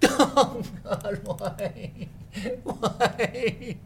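high, wailing broken lament; mock despair. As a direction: wail brokenly to the heavens. Breaks down over rejected novel.

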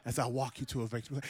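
low, soft, intimate promise; soft tender Jesus. Acted. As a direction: draw them close, almost hushed. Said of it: "and he says".

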